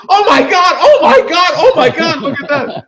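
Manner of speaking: very high, loud shouting squeal; party guests shrieking at a football cake; shrieking women. Retold or imitated. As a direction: scream with delight at the cake.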